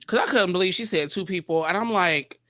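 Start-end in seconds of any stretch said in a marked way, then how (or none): none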